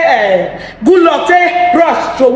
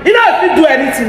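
ordinary delivery

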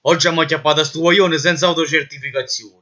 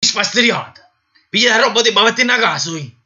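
angry shouting; 7 semitones higher than usual. Acted. Marshal throws him out.

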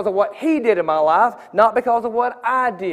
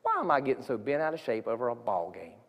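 much softer and lower, talking to God inside; quiet inner prayer; 7 semitones lower, 10 dB quieter than usual. Retold or imitated. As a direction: murmur it as a private prayer. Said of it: "And I thought".

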